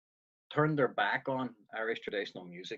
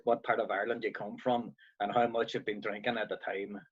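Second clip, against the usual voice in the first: usual speaking voice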